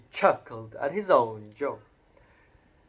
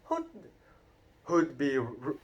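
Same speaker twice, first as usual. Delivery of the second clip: sneering; 5 semitones higher, 5 dB louder than usual. A narrator's reading. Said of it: "sneered Uncle Vernon".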